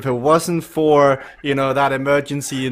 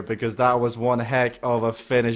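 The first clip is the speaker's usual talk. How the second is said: usual speaking voice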